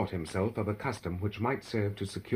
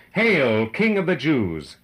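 raised, proclaiming hail; loud mock salute; 4 semitones higher, 7 dB louder, slower than usual. A narrator's reading. Soldiers salute their crowned prisoner, in a loud hail. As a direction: hail him loudly, like a salute.

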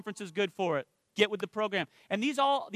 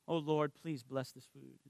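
softer, slow, lower mock prayer; hushed grace; 6 semitones lower, 9 dB quieter, slower than usual. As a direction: say grace slowly and softly. Blessing his food in prayer.

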